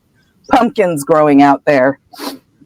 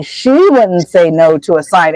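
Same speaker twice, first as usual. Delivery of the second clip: ordinary talk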